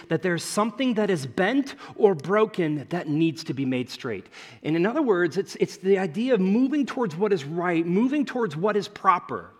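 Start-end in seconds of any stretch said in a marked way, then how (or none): none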